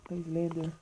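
ordinary talk

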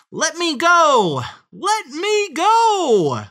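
very high, loud, stretched squeal; squealing piglet. A narrator's reading. Piglet struggles in the fox's grip, squealing. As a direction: wail it out like a trapped piglet. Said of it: "The piglet kicked and squealed".

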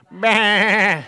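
bleating bah; sheep baa. Shown as a demonstration. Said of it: "we say".

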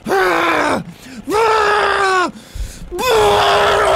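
rising battle yells; game face yell. Shown as a demonstration. Shouting his game face at viewers. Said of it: "let me see your game face".

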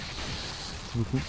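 whispered, slow mock thanks; sarcastic whisper. Real, not acted. Gamer mock-thanking the kicked monster, whispered. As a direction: breathe the thanks out dryly.

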